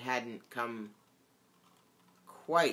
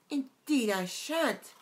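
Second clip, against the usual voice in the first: put-on much higher and slow, a girl out of patience; impatient Alice; 11 semitones higher, 3 dB louder than usual. A narrator's reading. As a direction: let her patience run out on every word. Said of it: "Alice said rather impatiently".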